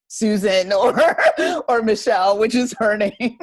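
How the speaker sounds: real laughter breaking into the words; laughing. Real, not acted. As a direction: giggle out the joke names. Naming joke about her sister.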